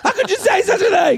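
high, laughing mock outrage; offended fan. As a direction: gasp at the insult in fake shock.

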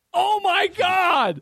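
stunned realisation; shocked shout. Real, not acted. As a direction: scream it in disbelief.

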